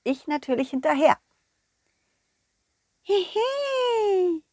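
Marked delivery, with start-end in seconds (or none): 3.0-4.4 fairy giggle. Acted.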